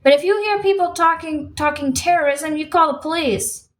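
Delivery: heated protest, pitched high. A narrator's reading. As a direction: argue back with the voice lifted. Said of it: "her voice raising automatically".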